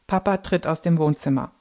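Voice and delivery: plain reading voice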